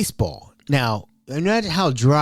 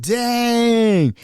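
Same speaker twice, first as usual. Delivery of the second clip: mock surprise; 9 semitones higher than usual. Couch breaks under dad.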